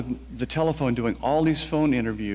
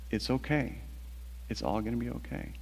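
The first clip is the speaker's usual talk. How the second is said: soft, tender reassurance; gently reassuring; 9 dB quieter than usual. Retold or imitated. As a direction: say it softly, as if comforting someone hurt. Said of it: "very gently kind of rolls me over and says".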